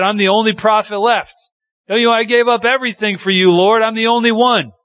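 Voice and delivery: high, fast, pleading complaint; a prophet whining to God, high and hurried; whiny Elijah. Retold or imitated. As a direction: rush out Elijah's self-pitying lament. Elijah's complaint voiced in story.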